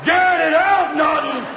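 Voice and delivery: high loud bellow; Ralph Kramden. Retold or imitated. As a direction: roar like Kramden at Norton. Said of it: "transforming into Ralph fucking Kramden".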